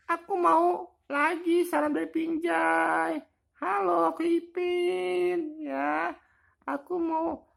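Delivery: very high squeaky toy voice; squeaky Ipin voice. Pretend. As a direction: chirp it like a tiny cartoon kid.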